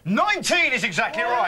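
high, loud quizmaster's announcement; triumphant confirmation. Real, not acted. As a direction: declare the right answer as a big win. Host announces guest's correct answer.

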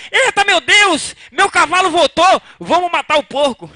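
high excited cry of joy; a farmer overjoyed, then plotting the pig's death; owner exclaiming. Retold or imitated. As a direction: burst out thrilled the horse is back. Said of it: "Aí o dono falou".

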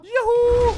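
high loud whoop of delight; excited whoop. Real, not acted. Gamer cheering Hulk's big jump, loud whoop. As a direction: let out a joyful yell.

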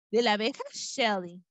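plain reading voice

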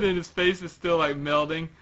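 plain reading voice

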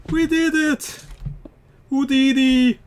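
very high, loud mock cheer; squealed celebration.

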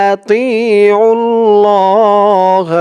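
slow, drawn-out chanted recitation; slow melodic Quranic chant. Acted. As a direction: intone it slowly like tilawat. Recites verse.

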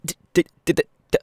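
high, loud stuttered t-sounds; stuttering tic. Acted. [name] tics mid-sentence while reassuring a stranger, loud stutter. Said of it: "I have Tourette's".

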